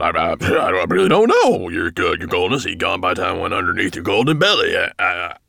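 stammering, flustered dwarf; babbling. A narrator's reading. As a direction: babble excuses to an angry master. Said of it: "he babbled".

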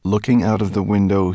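plain reading voice

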